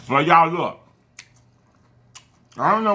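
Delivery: talking with food in mouth; words between bites; chewing while talking. Real, not acted. Seen 1: Bites taken just before; lips pursed and working, fork in hand.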